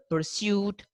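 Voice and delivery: pursuit as "per-syoot", drawn out; y glide after the s in pursuit; British pronunciation. Shown as a demonstration. Teacher giving a similar example, British "per-syoot" for pursuit. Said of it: "while they say pursuit".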